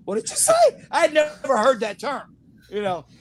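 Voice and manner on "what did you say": rising high with each repeat; stunned disbelief. Retold or imitated. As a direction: ask back, shocked. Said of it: "I said".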